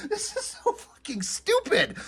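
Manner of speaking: high, squeaky words through laughing; helpless laughter. Real, not acted. Reviewer breaking down over silly comic writing, laughing helplessly. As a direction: crack up while saying it. Cracks up at the text.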